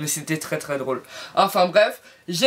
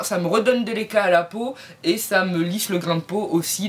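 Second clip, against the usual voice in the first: ordinary talk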